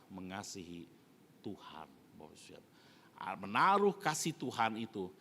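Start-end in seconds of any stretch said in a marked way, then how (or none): 0.1-2.7 hushed ending of the point; whisper. Real, not acted. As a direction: drop to a whispered close. Preacher drops to a hush.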